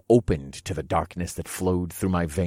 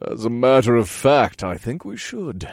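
mocking snicker, louder start; 5 semitones higher, 6 dB louder than usual. A narrator's reading. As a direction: sneer it as a cruel creature.

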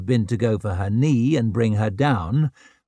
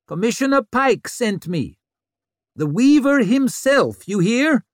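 loud; 11 semitones higher, 4 dB louder than usual. A narrator's reading. Vic addresses angry crowd.

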